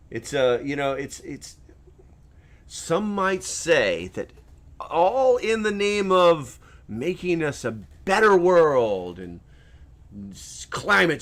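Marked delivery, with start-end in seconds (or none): none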